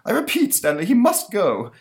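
high, loud, insistent monster. Acted. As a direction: demand it firmly.

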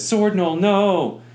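high, loud grief-stricken wail; anguished cry. Acted. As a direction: cry out for a fallen friend. DM voices a gnoll in rage.

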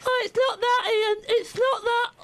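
very high, exaggerated imitation of a colleague; mocking co-host's voice. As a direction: squeak it out as a hammed-up co-host. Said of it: "Is that my voice?".

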